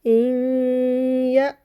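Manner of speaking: इन या with the नून hummed through the nose into या; slow chanted recitation; nasal ghunna. Shown as a demonstration. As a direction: hold the nasal sound. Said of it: "पढ़ लेते हैं".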